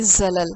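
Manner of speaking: زلل with a hissing, whistling ز; whistled z. Shown as a demonstration. Teacher showing students the whistling ز of زلل. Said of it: "سیٹی کی طرح سے پڑھا جائے گا".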